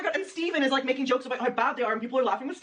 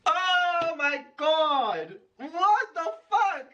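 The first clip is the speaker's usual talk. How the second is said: slow, higher, stretched exclamation; drawn-out disbelief; 5 semitones higher, much slower than usual. Real, not acted. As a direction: groan it out in disbelief. Mocking a bad comedy set.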